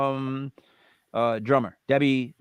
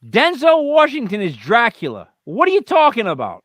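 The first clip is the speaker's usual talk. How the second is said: higher and louder defensive rant; exasperated loud; 10 semitones higher, 8 dB louder than usual. Real, not acted. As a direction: push back, exasperated and loud. Defensive outburst at friend.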